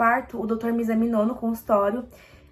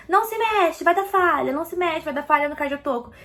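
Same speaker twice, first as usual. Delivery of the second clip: scolding doctor; 5 semitones higher than usual. Retold or imitated. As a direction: snap orders at a patient who won't keep still. Doctor scolding her to stay still.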